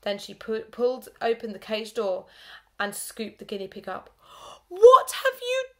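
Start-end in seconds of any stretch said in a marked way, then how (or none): 4.7-5.6 huge high slow yell; furious teacher yelling at her pupils. A narrator's reading. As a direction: bellow in horror. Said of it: "she yelled".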